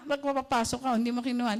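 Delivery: ordinary delivery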